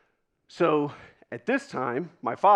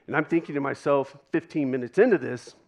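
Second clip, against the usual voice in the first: usual speaking voice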